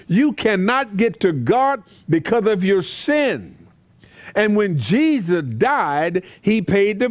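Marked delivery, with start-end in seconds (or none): none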